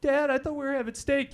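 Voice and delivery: put-on high whining child voice. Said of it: "tipsy dad".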